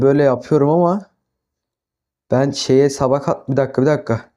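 ordinary talk, nothing marked

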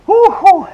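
two high, loud whoops; exertion whoop. Real, not acted. Instructor after down dog, high exertion whoops. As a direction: whoop twice, high and loud, pushing through. Whooping through a hard workout.

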